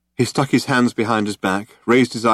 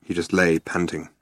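usual speaking voice

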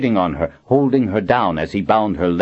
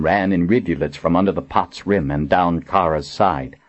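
ordinary delivery

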